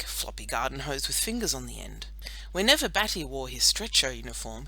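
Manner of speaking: ordinary delivery, nothing marked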